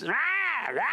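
high imitated bawling; high mock tantrum wail; mock crying wail. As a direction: imitate an aggravating child's tantrum cry. Imitating sister's aggravating crying.

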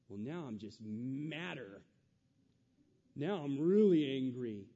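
slow, sulky grumble that bursts into a loud huff; mock angry retort. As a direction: play the stubborn listener who gets madder. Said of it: "we hear those things and we go".

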